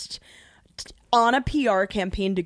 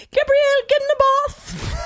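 a South African mom calling her daughter to the bath, pitched much higher; South African mom accent. Retold or imitated. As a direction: shout it up high like a South African mother giving orders. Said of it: "Do a South African accent".

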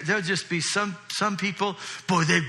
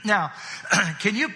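ordinary delivery

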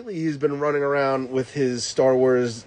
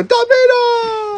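put-on very high, loud, slow child's cry; excited child; 6 dB louder, much slower than usual. Son staring wide-eyed at dad, high slow excited cry. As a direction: stretch it out like a wide-eyed kid. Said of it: "looking at me and go".

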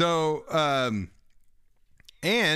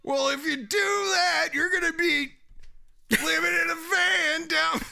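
shouting Matt Foley. Acted. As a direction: bellow "living in a van down" like the over-the-top speaker. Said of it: "That's a pretty good impression".

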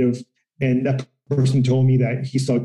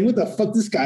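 pitched way up in mock disbelief; incredulous; 7 semitones higher than usual. Telling a podcast co-host his past reaction, higher mock disbelief. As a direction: reenact your stunned reaction, higher. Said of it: "And I was like".

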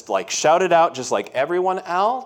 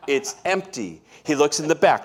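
ordinary talk

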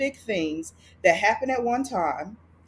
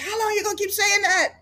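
exasperated; 12 semitones higher, faster than usual. Retold or imitated. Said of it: "I was like".

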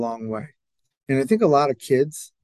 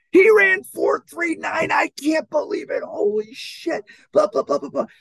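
imitated excited yelling; 13 semitones higher, 4 dB louder than usual. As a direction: yell it like you can't believe the time. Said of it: "he's done yelling and screaming".

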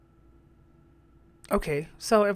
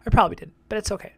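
ordinary talk, nothing marked

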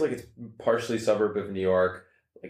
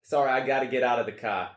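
raised-pitch Sopranos-style Jersey accent; New Jersey accent; 5 semitones higher than usual. Shown as a demonstration. Showing the others the third accent, New Jersey. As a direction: play a Jersey character from the Sopranos. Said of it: "that's a little bit Sopranos".

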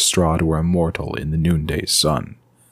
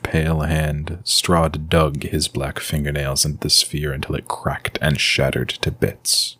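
ordinary delivery, nothing marked

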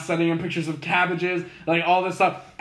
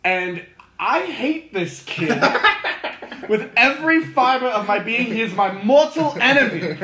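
louder, higher mock-British declaration; British accent; 6 semitones higher, 5 dB louder than usual. Comedian declares a boy his mortal enemy, imitated British accent. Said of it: "in a British accent".

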